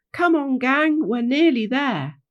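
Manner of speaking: high, loud encouragement; rallying call. Acted. Leader calls the gang onward.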